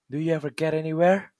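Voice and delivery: high, fast mocking taunt; mocking laugh. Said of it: "he asked with a mocking laugh".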